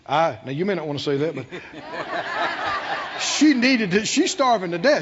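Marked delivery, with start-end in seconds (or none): 1.5-3.2 high, breaking laughter; real extended laugh; hearty laugh. Real, not acted. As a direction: burst out laughing mid-story. Amused at his own story.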